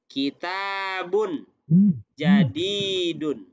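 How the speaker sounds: kitabun jadidun stretched syllable by syllable; slow example reading. Shown as a demonstration. Teacher giving students an example of kembar, slowly drawn out. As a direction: draw out the long vowels in kitabun jadidun.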